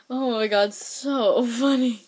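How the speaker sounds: normal talking voice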